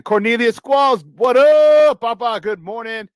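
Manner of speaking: loud, high, drawn-out shout-out; shouted greeting. Real, not acted. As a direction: holler hello to a regular listener. Calling out greeting to texter.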